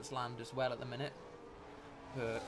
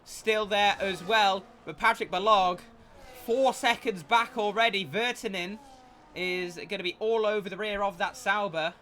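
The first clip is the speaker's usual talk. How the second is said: motorsport commentator; 6 semitones higher, 9 dB louder than usual. Real, not acted. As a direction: call the race live. Live play-by-play commentary.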